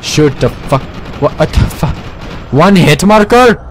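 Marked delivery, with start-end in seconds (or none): 2.5-3.6 loud, high indignant question; can't believe it, shout it; outraged disbelief. Real, not acted. Outraged at game.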